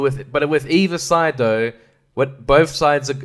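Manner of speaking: normal talking voice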